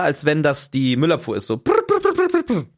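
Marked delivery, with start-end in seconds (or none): 1.6-2.6 high trilled bursts; rapid high-pitched rolled rings; imitated doorbell ringing. Storyteller shows the familiar garbage-collection ring, imitated trilling bell. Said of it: "Es klingelt genauso wieder nach dem Schema".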